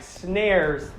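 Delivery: ordinary talk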